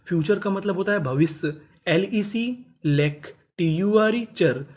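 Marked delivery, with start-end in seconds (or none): 1.9-4.6 slowly, spelling; बहुत धीरे, अक्षर-अक्षर; syllable by syllable. Shown as a demonstration. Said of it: "spelling out syllables".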